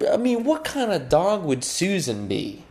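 usual speaking voice

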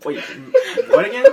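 high, loud laughing question; can barely ask through laughter. Real, not acted. Cracking up at the answer.

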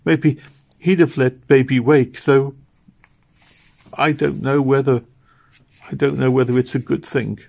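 a touch of sobbing despair; grieving girl. A narrator's reading. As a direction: pour out grief beside the bed. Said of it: "she cried to herself".

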